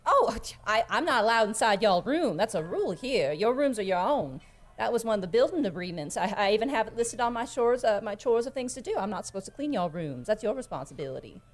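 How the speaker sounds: folksy character voice, gently protesting; motherly tavern hand standing on the rules; Skinny, politely refusing. Acted. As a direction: explain the house rules, mildly defensive. Plays Skinny refusing a chore.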